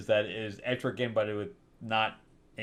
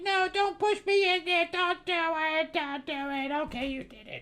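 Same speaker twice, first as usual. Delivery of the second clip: very high whiny begging; mock pleading. Knight begging not to be pushed into the castle, high and whiny. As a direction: whimper it like a kid dragged somewhere. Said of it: "He's like".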